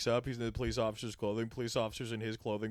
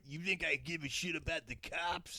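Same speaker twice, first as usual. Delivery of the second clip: tough punk sneer; 4 semitones higher, 7 dB quieter than usual. Retold or imitated. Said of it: "he went".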